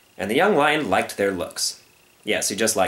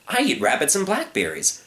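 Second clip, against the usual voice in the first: much higher, innocent voice; protesting young lion; 9 semitones higher than usual. Acted. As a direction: plead harmlessness earnestly.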